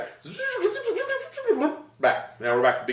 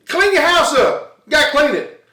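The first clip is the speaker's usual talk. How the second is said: higher, louder, slowed scold; mock scolding; 8 semitones higher, 5 dB louder than usual. Comic telling the audience off for dirty carpets, mock scolding. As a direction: lay into them like a nagging parent. Scolding nasty people about cleaning.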